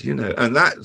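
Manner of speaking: ordinary delivery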